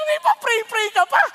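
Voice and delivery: ordinary talk, nothing marked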